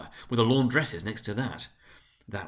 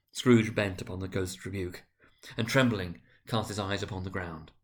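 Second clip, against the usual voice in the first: ordinary delivery, nothing marked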